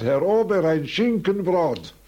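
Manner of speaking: mildly imitated Russian accent, calling across the room. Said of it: "rief er durch das ganze Lokal mit seinem sehr russischen Akzent".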